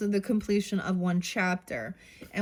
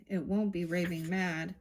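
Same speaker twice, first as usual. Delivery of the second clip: normal talking voice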